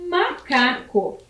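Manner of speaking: MACACO slowly split into three syllables. Shown as a demonstration. Teacher completes the word for pupils, syllable by syllable. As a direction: say MACACO in separate pieces, MA, CA, CO. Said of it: "E a última sílaba?".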